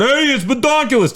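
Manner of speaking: high, put-on heckling voice; acted taunt. Teller acting out the comment to his sister, high put-on taunt. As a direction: call it out high-pitched.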